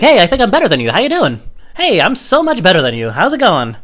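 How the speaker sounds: high, loud mock-friendly greeting; hammed-up patronizing hello; condescending. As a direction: talk down loudly with fake cheer. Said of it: "it's just so condescending".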